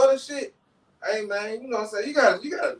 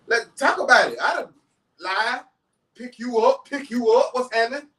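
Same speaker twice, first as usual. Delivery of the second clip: retold heated confrontation; 6 semitones higher than usual. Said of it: "man, like".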